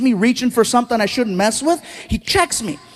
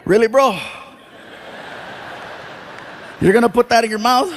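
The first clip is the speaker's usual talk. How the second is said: dry callback scold. Retold or imitated. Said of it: "I gotta look at him and say".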